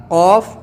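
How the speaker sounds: "قاف" said thick from the palate; thick, heavy letter. Shown as a demonstration. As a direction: hit a heavy, rounded "قاف". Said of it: "تالو سے نکلنا ہے اور موٹا کر کے نکلنا ہے".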